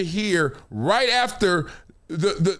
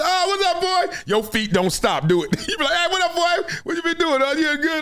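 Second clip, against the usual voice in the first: high mock friendly greeting; 9 semitones higher than usual. Greeting a friend while leaving.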